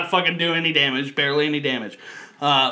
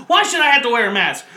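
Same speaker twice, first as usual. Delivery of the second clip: mock angry yell; 6 semitones higher, 3 dB louder than usual. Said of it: "he starts yelling at one of the other passengers".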